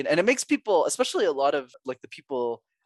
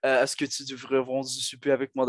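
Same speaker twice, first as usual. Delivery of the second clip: speaking Montreal French. Shown as a demonstration. Showing the host a fun accent, in French. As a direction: switch into easy conversational French. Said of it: "I can do French".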